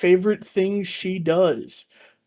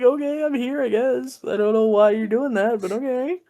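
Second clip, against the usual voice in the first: higher, puzzled and unbothered; clueless girl voice; 6 semitones higher than usual. Retold or imitated. The girl says she doesn't know why, lightly voiced confused. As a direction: play her as sweetly lost. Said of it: "She's like".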